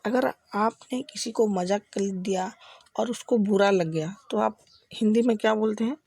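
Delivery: ordinary talk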